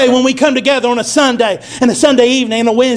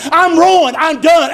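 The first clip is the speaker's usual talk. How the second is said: ordinary talk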